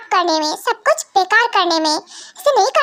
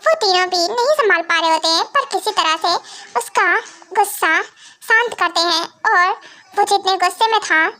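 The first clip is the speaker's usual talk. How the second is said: usual speaking voice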